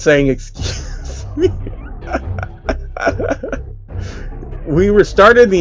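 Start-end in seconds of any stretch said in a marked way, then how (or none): 0.3-1.5 high, slow whispered excuse me; character voice. Acted. DSP character mock-polite aside. 1.5-4.6 high whispered giggle; a high airy character titter. Acted. Character laughs after burp.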